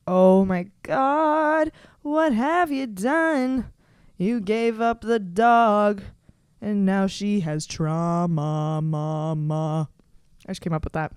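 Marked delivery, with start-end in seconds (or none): 0.1-9.9 dog scolding mama. Pretend. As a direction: drag it out like a wounded pet. Imagined dog scolding her.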